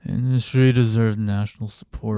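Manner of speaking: John Fetterman. Acted. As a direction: read it out haltingly as an impersonation. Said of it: "read it in John Fetterman's voice".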